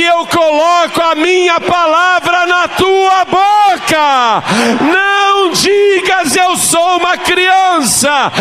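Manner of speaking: full-force Pentecostal preaching, building to a shout; fiery preaching. Acted. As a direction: thunder God's words at the congregation. Preaching Jeremiah's call at full force.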